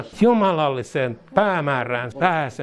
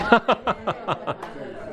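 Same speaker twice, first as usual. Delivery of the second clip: rapid chuckling laugh; 6 semitones higher than usual. Real, not acted. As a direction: let a quick laugh tumble out mid-prayer. Laughs amid praise.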